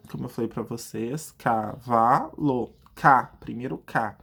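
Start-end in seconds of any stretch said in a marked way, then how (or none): 1.4-2.7 "cavalo" said slowly in clear syllables; syllable demo. Shown as a demonstration. Teacher modelling the sounds of the word, slow syllables. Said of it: "identifique o som daquela palavra".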